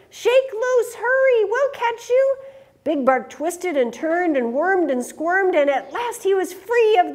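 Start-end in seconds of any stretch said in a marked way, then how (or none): 0.1-2.4 much higher urgent call; high, eager little rescuer's cry; hammed-up shout. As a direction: call up to him to break loose. Prairie dog urging rescue.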